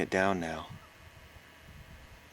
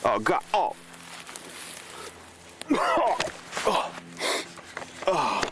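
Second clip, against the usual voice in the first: frightened gasps; 9 semitones higher, much slower than usual. Acted. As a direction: gasp out each oh in sudden horror. Finds something horrible in the dark.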